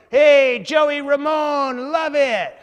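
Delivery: loud, high, drawn-out street holler; imitating a biker's whooping shout. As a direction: yell it like a rowdy biker hailing a celebrity. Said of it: "homeless man, dressed up as a Hollywood biker".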